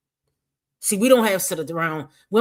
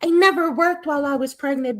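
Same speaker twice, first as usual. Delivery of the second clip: higher, louder mocking parrot of her words; mocking imitation; 10 semitones higher, 6 dB louder than usual. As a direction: throw her own line back at her as a sneer. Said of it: "acting like they had a job".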